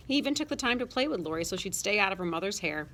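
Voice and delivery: plain reading voice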